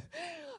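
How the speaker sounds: high, squeaky nervous laugh. Retold or imitated. Lawyer laughing nervously before an angry crowd. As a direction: let out a frantic high giggle.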